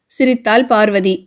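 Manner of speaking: plain reading voice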